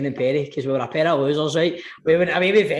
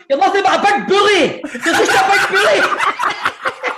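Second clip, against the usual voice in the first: high, laughing, stretched whine; mate hiding behind the sliding door, high whiny protest; 13 semitones higher, 4 dB louder than usual. Retold or imitated. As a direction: squeal it like a scared friend behind glass.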